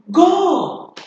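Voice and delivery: loud, high, drawn-out order; strong command. Shown as a demonstration. Teacher showing class an exclamation command, loud and commanding. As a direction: call out the order forcefully. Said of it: "This one is about strong command".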